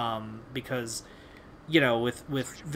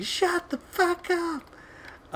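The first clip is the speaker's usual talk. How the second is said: much higher, slow drawn-out mock yell; random dude yelling; much slower than usual. Random dude silencing the chat, slow mock yell. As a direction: stretch it out like a random guy silencing the lobby. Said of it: "some random dude was just like".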